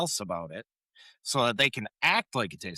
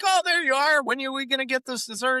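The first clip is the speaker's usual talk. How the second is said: eager Joey; 13 semitones higher, faster than usual. Retold or imitated. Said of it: "Joey's like".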